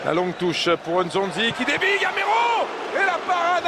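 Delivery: rising to a shout of the name; excited sports commentary. Real, not acted. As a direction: build up and call the striker's name. Chance on goal, name called.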